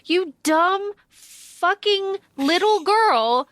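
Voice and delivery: slow, exasperated, lightly put-on Castle; imitating Castle. Castle scolding Juliet, slow and exasperated. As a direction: spell out Castle's contempt slowly. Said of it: "And Castle's like".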